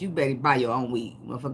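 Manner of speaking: normal talking voice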